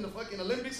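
normal talking voice

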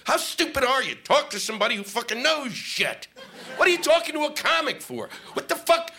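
comic on the phone to USA Today, exasperated rant; retold angry rant. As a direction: tell off the newspaper caller. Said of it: "I said".

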